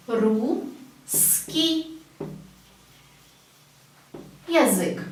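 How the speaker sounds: "русский язык" in pieces, ru... skiy... with long pauses; spelled-out delivery. Shown as a demonstration. Teacher writing it out, "русский язык" broken into slow pieces. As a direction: draw out "русский" by syllable, wait, then "язык". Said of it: "It's written like this".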